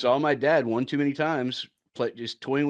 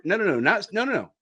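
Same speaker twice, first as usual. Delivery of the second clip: usual speaking voice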